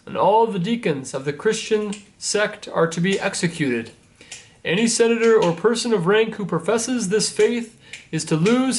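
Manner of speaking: herald's proclamation. Acted. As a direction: announce it to the square like a Roman crier. Messenger reads decree to crowd.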